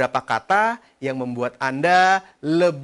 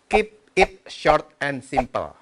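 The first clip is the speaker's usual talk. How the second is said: slow, spelled-out motto; slow and deliberate; much slower than usual. Shown as a demonstration. Coach stressing the kiss motto, slow deliberate. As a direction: give each word its own weight, slowly. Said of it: "dibikin kiss".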